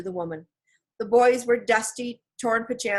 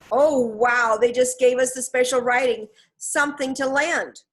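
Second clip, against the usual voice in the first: louder, higher, thrilled; excited; 4 semitones higher, 6 dB louder than usual. A narrator's reading. Annie realizing they found the special writing, excited. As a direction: burst out with the discovery.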